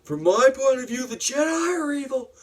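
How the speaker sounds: high, strained theatrical outburst; hammed-up anguished defiance; Anakin's angry accusation. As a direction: hurl the accusation in a high acted yell. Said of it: "blamed it all on Obi-Wan and says".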